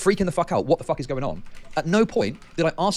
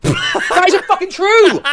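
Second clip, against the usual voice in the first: gasping, then yelling in outrage; real outraged yell, high and loud; furious shout; 11 dB louder, much slower than usual. Real, not acted. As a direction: shout it at the top of the lungs, slow and stunned. Outraged at false accusation.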